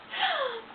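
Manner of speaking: high giggle; laughing. Real, not acted. Mother remembering her reaction to the news, high laugh. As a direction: laugh at your own past reaction. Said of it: "I'm laughing because I'm thinking".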